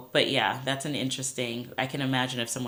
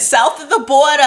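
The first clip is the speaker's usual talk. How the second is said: mock announcer; 14 semitones higher, 13 dB louder than usual. Repeats joke line, louder.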